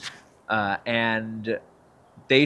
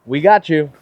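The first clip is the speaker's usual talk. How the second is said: lightly raised, half-kidding offer; half joking, half serious; 4 semitones higher, 7 dB louder than usual. Retold or imitated. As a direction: pitch it as a half-serious joke. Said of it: "half joking, half serious".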